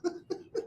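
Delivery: airy laugh under the breath; breathy unvoiced laugh. Real, not acted. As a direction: let out a soft airy laugh. Host laughs at caller.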